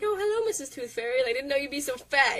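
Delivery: put-on high, innocent little boy voice; Butters voice. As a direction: play it sweet and naive. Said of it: "Butters is like".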